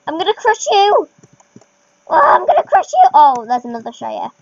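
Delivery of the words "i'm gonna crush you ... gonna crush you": mock menace rising high and loud; playful battle yell; playful threat. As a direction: call out a gleeful pretend threat, escalating. Playfully threatening players while riding.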